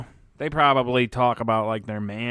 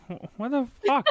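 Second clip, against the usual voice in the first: voicing the cat; 12 semitones higher than usual. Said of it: "Your cat's looking at you like".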